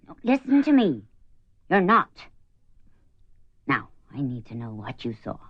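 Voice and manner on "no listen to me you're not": louder, pressing it home; firm insistence. Acted. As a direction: make her believe you, forcefully. Firmly insisting to frightened woman.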